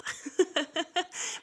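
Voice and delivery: short high breathy laugh; soft embarrassed laugh. Real, not acted. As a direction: laugh it off lightly. Laughing at her own admission.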